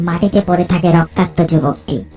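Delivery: ordinary delivery, nothing marked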